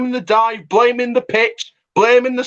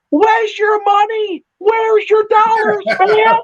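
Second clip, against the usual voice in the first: taunting; 10 semitones higher than usual. Real, not acted. Taunting rival club owners.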